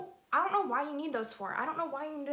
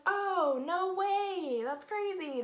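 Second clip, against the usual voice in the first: feigned surprise; 7 semitones higher, much slower than usual. Retold or imitated.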